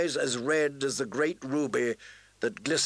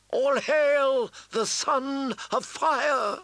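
proclaiming; 10 semitones higher than usual. A narrator's reading. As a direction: hail the chosen one to a crowd. Melisandre proclaims to the host.

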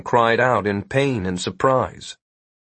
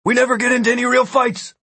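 fast, high-pitched yell; 13 semitones higher, faster than usual. A narrator's reading. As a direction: yell it back hurriedly across a distance. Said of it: "and yelled".

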